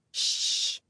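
hushed shush; whisper. A narrator's reading. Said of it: "whispers like we're in church".

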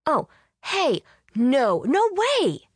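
high, loud outraged protest; yelling. A narrator's reading. Fan jumps up protesting at the TV, yelling. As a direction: yell it out, drawing out the no. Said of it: "jumped up from the sofa and yelled".

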